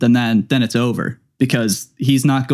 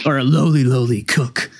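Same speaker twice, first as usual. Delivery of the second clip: sly line. Retold or imitated. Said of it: "a sly line".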